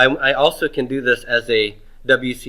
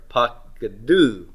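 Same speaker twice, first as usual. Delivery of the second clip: pakadu slowly, with a raised, drawn-out DU; stress on last syllable; 3 dB quieter, much slower than usual. Shown as a demonstration. Teacher shows a vocalic ending, pakadu slowly stressed on the final DU. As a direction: push the accent onto the end of pakadu, paka-DU. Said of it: "vocalic ending".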